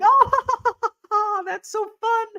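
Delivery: very high mock-giggly delight; squealed, laughing re-enactment; imitated laughing. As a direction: act out helpless kiddie laughter. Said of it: "So we're laughing".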